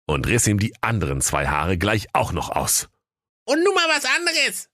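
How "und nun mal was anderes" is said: very high shrill granny voice; a shrill devilish old receptionist. A narrator's reading. The devil's grandma greets the visitor, very high shrill voice. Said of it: "fragte die Omi".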